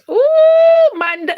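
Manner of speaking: ordinary talk, nothing marked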